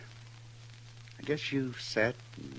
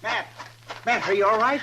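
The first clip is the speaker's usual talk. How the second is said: anxious call; 12 semitones higher, 9 dB louder than usual. Acted. Checking on Matt after danger.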